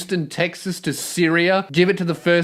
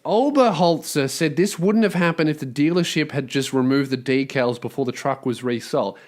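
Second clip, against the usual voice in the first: lower, even reading of the report; news article read; 4 semitones lower, 3 dB quieter than usual. A narrator's reading. Host reads the news story to listeners, dry lower read. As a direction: read the lawsuit report straight.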